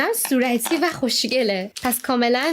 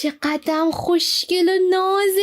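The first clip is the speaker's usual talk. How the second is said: 1 thrilled squeal; 10 semitones higher, slower than usual. Real, not acted. Gets the figure she wanted.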